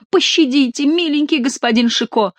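high, pleading cry; babbling plea. Acted. Monk feeling the blows, high babbling plea. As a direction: whimper for mercy. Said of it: "лепетал Горанфло".

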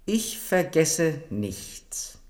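slow and deliberate. Acted. Queen's weighty promise.

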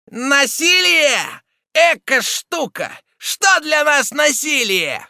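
very high, slow, loud jeer; shrew's shout. A narrator's reading. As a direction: yell it scornfully, stretching the words. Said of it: "кричала Мегера".

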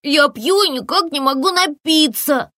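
put-on high whiny little boy, complaining; complaining child. A narrator's reading. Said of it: "жаловался малыш". (жалобный детский голос)

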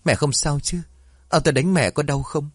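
high-pitched anxious questions; lightly voiced son checking on his hurt mother; worried. A narrator's reading. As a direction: ask with gentle alarm, pitched well up. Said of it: "ôm bà Xuân đỡ dậy lo lắng".

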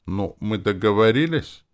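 conciliatory. A narrator's reading. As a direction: ask hopefully to settle it. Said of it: "спросил примирительно". (примирительно, с вопросом)

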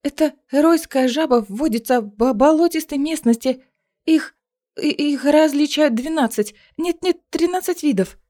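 nervous high stammer. Acted. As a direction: stumble over the words, nervous. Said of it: "заикаясь".

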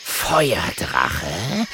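drawn-out snarled question, rising; hissing, incredulous panther. A narrator's reading. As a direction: snarl it back like a big cat. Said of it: "faucht der Panther".

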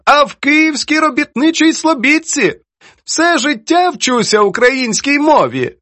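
high, emphatic mock indignation; indignant old poetess. Poetess complains to her friend about editor, mock-indignant. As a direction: play her high and theatrically aggrieved.

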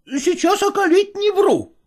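frightened footman. A narrator's reading. Footman swears, backing off.

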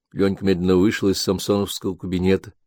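ordinary delivery, nothing marked

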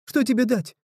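put-on high, pleading boy voice; a trapped boy begging; scared young boy. A narrator's reading. Frightened boy Blodi pleads.

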